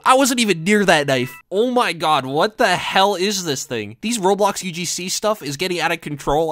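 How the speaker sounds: ordinary delivery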